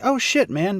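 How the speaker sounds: high, startled exclamation; surprised. Retold or imitated. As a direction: blurt it out in surprise. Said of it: "I was like".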